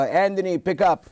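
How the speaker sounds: put-on lightly Italian-accented dad calling his son; a hint of an old Italian father; son's father on voicemail, mild Italian accent. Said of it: "with that accent".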